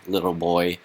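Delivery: ordinary talk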